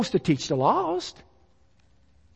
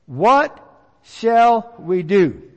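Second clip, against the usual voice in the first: slow pleading question; much slower than usual. Retold or imitated. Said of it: "they asked the question".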